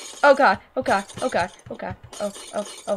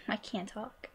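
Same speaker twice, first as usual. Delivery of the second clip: ordinary delivery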